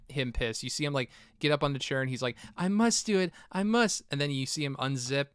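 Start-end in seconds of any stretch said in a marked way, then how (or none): 2.6-4.0 high-pitched imitation of a determined kid; mock-heroic kid. Host voicing the kid about to act, high mock resolve. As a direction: put on a high, melodramatic resolve. Voices kid psyching himself up.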